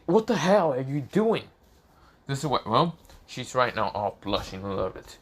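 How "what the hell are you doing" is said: shocked outburst. Acted. Kissed suddenly, pushes him away.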